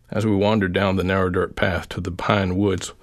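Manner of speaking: ordinary delivery, nothing marked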